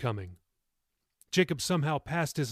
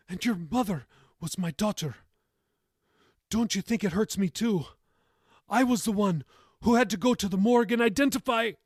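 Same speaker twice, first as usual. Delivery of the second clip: high, pained, tearful protest; tearful anguish; 7 semitones higher, 4 dB louder than usual. A narrator's reading. Grandmother telling grandson her own pain, tearful. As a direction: let the grief break through.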